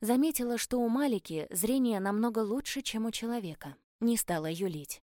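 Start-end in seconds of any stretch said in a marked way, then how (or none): none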